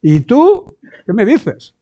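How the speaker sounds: rising offended comeback, dragged out at the end; wounded believer rebuffing correction, indignant and slowing; indignant retort. Retold or imitated.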